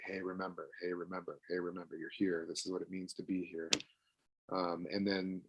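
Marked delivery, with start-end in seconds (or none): 0.1-3.7 much softer, low and hushed; gentle reminder. Shown as a demonstration. Parent softly reminding a child of the house rules. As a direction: ease the kid back with a soft hush.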